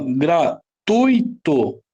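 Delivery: "gratuito" slowly split as gra - TUI - to; slow, separated syllables in "gratuito". Shown as a demonstration. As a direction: sound out "gratuito", stressing the middle chunk TUI. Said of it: "Essa aqui é a penúltima?".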